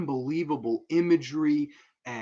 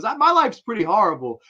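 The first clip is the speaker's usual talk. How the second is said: mock lament; 8 semitones higher, 8 dB louder than usual. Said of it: "He goes".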